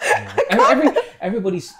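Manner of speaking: loud, high, fast laughing talk. Real, not acted. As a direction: laugh through the words. Laughing while talking about advice.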